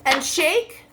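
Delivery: plain reading voice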